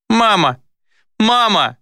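high, desperate calling for mother; high-pitched anguished call; anguished child's cry. A narrator's reading. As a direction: cry out like a stricken little boy. Child cries out as mother dies.

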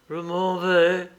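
lightly voiced dying man, pushing words out; dying man's repetition. A narrator's reading. Dying man repeating himself to his brother, laboured. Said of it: "répéta encore le mourant".

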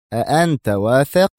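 ordinary delivery

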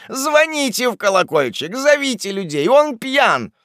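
high, alarmed bellowed orders; furious shouting. Acted. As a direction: roar for help in a rage. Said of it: "заревел старик Уардель страшным голосом".

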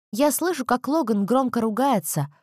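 ordinary delivery, nothing marked

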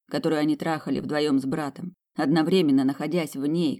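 ordinary delivery

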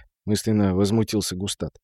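ordinary delivery, nothing marked

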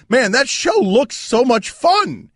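high, slow, gushing fan; excited fan voice. Retold or imitated. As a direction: gush like a thrilled fan. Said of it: "with fans in his eyes says".

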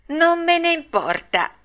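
high-pitched bratty retort; defiant. Acted. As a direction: brush the warning off like a stubborn puppet. Pinocchio defies the fairy.

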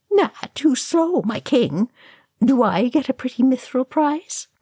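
high, playful teasing jab; cheeky taunt. A narrator's reading. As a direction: tease him like a cheeky cousin. Oin teasing after ducking.

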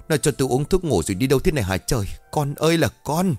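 mother lamenting her missing son, mildly wailing; mock wailing. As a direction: fret and lament out loud. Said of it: "Đang bù lu bù loa".

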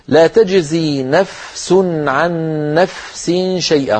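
slow, with the فاء of نفس soft and lengthened; soft, drawn-out fa. Shown as a demonstration. Teacher showing how to give the weak fa its length, slow recitation. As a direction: let the فاء in نفس flow long before the sin. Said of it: "هنا الفاء يا إخوة حرف ضعيف".

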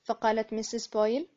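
ordinary delivery, nothing marked

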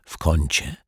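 ordinary delivery, nothing marked

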